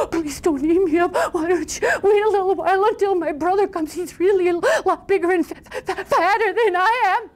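high, stammering frightened little voice; small high voice, tripping over words; tiny timid goat. Acted. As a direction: stutter nervously like a scared baby goat. Tiniest goat pleads with troll.

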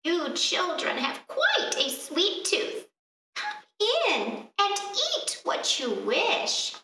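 high, slow, sugary crone voice; sweet old witch. Acted. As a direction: lure the children in slowly. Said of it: "said the old woman".

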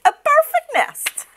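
very high goose exclamation; excited cry. A narrator's reading. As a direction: marvel in a high voice. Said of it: "she cried".

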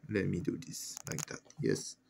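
ordinary talk

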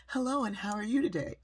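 hint of a grown-up-talking baby; precocious baby. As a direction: imitate a tot greeting like an adult. Imitates precocious baby greeting shoppers.

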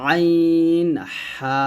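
আইন হা squeezed thin and held long; long, lightly pressed আইন হা; pressed, thin articulation. Shown as a demonstration. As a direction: press the mid-throat and stretch আইন হা thinly. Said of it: "একটু চাপ দিয়ে বা চিকন করে".

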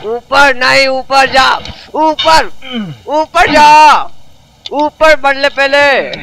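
shouting at the character to climb; frustrated shout, pushing him upward; yelling up. Real, not acted.

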